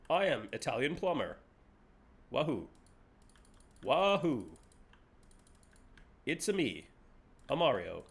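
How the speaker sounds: a hint of Mario, low and soft; quiet hammed-up Italian plumber; Mario impression. Streamer auditioning as Mario for Nintendo. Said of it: "Show us what you got".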